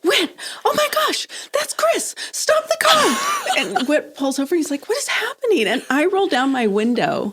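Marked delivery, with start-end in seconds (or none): 0.0-3.2 much higher, urgent excited blurting; wife to husband in the car, excited urgent blurting; excited outburst. Retold or imitated. Spotting the therapist from car. 4.9-5.7 baffled husband. Retold or imitated. Husband baffled as she stops.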